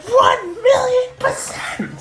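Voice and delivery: very high, slow, stretched battle scream; hammed-up anime finishing scream; ultimate attack cry. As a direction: scream it like the final blow.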